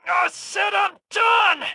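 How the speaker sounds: high, slow, enraged yell; furious shout. Acted. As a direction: bellow it to end the game. Demanding to be released.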